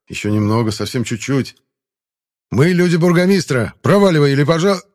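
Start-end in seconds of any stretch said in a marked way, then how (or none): 2.5-4.8 loud, high threatening yell, cut off; shouting. Acted. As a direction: bellow the threat until interrupted.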